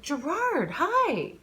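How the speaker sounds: high, loud, delighted hello; excited greeting. Acted. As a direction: she spots the waiter she knows, excited hello. Greeting old friend waiter.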